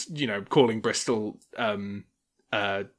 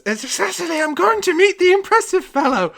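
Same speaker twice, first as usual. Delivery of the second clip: put-on high, loud old man's announcement; proud old man; 10 dB louder than usual. Old gentleman telling his wife he is going, high proud voice. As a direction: puff up like a proud old husband. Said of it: "and he says".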